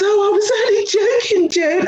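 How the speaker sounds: very high, whiny mock voice; mimicked excuse-making colleague. Colleague brushing off the complaint as a joke, high mocking imitation. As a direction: squeal the excuse in a drawn-up mocking pitch.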